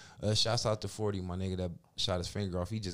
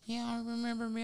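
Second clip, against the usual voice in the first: high, soft teasing question; little soft voice; 13 semitones higher, 5 dB quieter than usual. Retold or imitated. Caller teasing Mike on the phone, high soft imitation. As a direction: mimic her sweet voice asking if he forgot her. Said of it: "She talking with this little soft voice".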